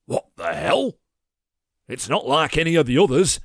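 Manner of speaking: high, loud astonished outburst; shocked. A narrator's reading. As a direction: blurt it out stunned. Leopold shocked through binoculars.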